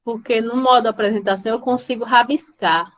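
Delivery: ordinary talk, nothing marked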